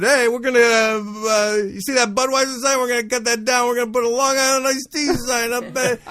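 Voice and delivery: high, loud ribbing barman voice; loud, high bartender taunting a newcomer; mocking bartender. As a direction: razz the kid loud for the whole bar.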